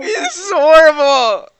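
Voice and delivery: high laugh-crying; crying with laughter. Real, not acted. Reacting to the cursed story.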